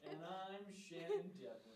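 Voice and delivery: high, slow, laughing mock anchor sign-on; drawn-out, loud presenter send-up; Shannon Devlin. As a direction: tease a co-host with an exaggerated intro. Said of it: "I can totally see you do it".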